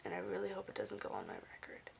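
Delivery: whisper. Real, not acted. Worried aside about the warning.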